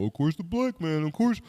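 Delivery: lightly hammed-up jeer with a fake ha ha ha. Mocking a stereotyped racist joke.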